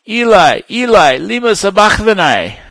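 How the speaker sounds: high, loud anguished cry; Jesus crying out on the cross. Acted. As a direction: cry out in despair. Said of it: "cried out with a loud voice".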